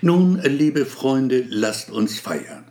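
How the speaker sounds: slow solemn address; measured, ceremonial speech. Acted. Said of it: "mit getragener Stimme".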